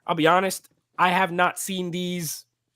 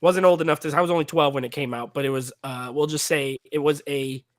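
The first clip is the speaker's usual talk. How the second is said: ordinary talk, nothing marked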